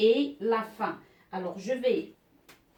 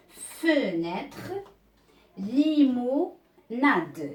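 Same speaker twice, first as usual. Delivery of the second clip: slow sounding out; much slower than usual. Shown as a demonstration. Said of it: "teacher sounding out words".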